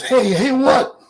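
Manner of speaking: high, startled champ's reply; Ali. Retold or imitated. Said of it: "And Ali goes".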